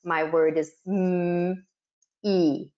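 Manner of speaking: "mm" held, then "e" as a separate sound, slowly; segmented sounds. Shown as a demonstration. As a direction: give the sounds "mm" and "e" apart for blending. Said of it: "When we give the sounds".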